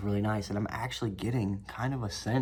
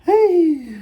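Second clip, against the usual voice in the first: sudden high shouted hey; mock yelled greeting; loud hey; 12 dB louder than usual. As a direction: bark a greeting at the camera.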